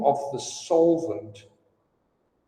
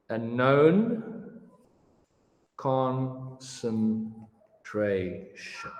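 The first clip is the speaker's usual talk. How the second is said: slow, drawn out, word by word with long pauses; slow dictation; much slower than usual. Shown as a demonstration. Teacher dictating the definition to students, slow and spaced. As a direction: spell out the definition so they can write it down. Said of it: "Let's look at the definition".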